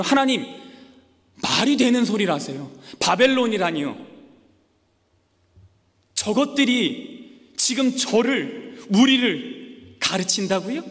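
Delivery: loud, high, slowing at the end; disbelief, almost scolding God; mock outrage at the Babylonians, loud; indignant protest. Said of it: "라고 대답하는 거예요".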